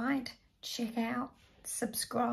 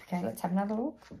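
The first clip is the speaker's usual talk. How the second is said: ordinary talk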